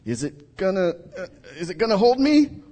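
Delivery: unsure, rising to a high nervous question; doubtful Peter testing the water; hesitant Peter. Retold or imitated. Peter wondering if the water holds him, nervous. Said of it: "Does Peter go".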